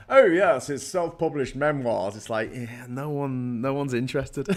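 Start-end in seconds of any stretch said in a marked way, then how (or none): 0.1-2.2 louder, higher mock snooty reply; hammed-up condescending voice; sniffy dismissal. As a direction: sneer at it like a publishing snob. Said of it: "They go".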